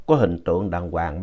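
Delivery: usual speaking voice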